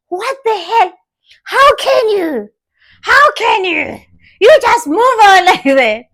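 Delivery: high, incredulous how can you; mock stunned ex. Man stunned his ex moved on so fast, mock disbelief. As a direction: ham up the ex's disbelief.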